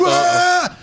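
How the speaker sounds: high mock yell of outrage; going nuts. Chicago guy set off by the argument, going nuts. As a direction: imitate a man exploding, high-pitched.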